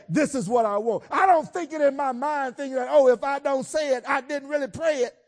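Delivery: high, loud, building preacher shout; preaching at full force. Acted.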